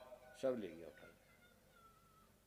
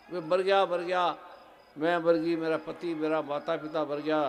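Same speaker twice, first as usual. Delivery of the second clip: mock wailing; 3 semitones higher, 12 dB louder than usual. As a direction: wail it out as if all are dead. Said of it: "चीख लो सारी". (ज़ोर से विलाप करते हुए)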